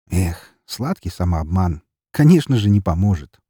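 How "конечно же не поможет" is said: ordinary delivery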